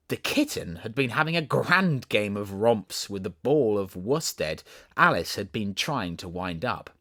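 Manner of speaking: ordinary delivery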